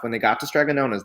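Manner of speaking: plain reading voice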